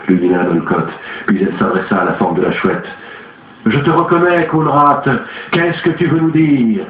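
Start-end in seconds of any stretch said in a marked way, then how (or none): 3.7-6.9 raised, insistent summoning voice; a séance leader pressing the spirit; commanding medium. A narrator's reading. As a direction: call the spirit out, high and urgent. Olcott addresses the owl spirit.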